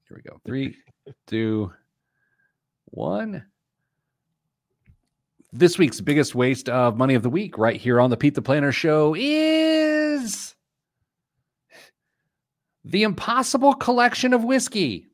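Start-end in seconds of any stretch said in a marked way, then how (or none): 5.5-15.1 big show announcer, sung-out "is". Acted. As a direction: hype the segment like a radio host. Segment announcement.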